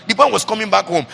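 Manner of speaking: normal talking voice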